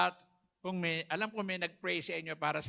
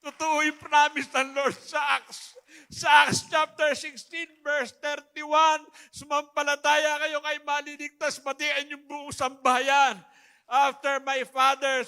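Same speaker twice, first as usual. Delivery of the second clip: loud, high, slow fervent preaching shout; preaching at full force; 7 semitones higher, 12 dB louder, slower than usual. Acted. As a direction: proclaim the verse to the whole hall. Preaching to congregation.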